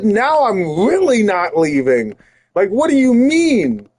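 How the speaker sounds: high-pitched, incredulous pushback; indignant refusal. Retold or imitated. Speaker telling the family friend they won't leave, indignant. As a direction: dig in, pitch jumping in disbelief.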